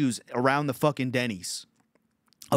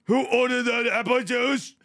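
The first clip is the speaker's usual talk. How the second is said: high caricatured waiter calling out; mock waiter; 10 semitones higher, 3 dB louder than usual. Repeats the waiter's call.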